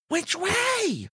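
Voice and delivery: put-on high, anxious boy's question; frightened boy. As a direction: ask clinging in fear. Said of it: "Todd asks, clinging".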